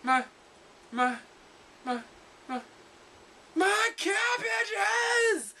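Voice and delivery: high, stretched-out despairing shout; cabbage merchant wail. Acted. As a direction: wail over the ruined cabbages. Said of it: "the Ponified version of".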